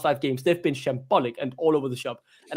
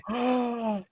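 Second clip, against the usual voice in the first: high, sharp gasp at the news; excited gasp; 7 semitones higher, 5 dB quieter than usual. Pretend. Fan hearing the manager was at Seagrave, excited gasp. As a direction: gasp as if it's really happening.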